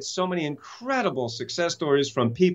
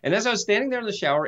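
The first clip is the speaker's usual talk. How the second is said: ordinary talk, nothing marked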